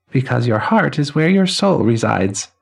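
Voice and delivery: put-on high, ringing elderly man's voice; chiming old man. Elderly man greeting the newly dead, high and chiming. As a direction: chime each word like silver cymbals and laughter.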